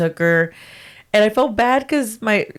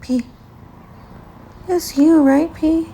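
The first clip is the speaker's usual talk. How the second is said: cooing at cat; 7 semitones higher, slower than usual. Real, not acted. Calling to the cat.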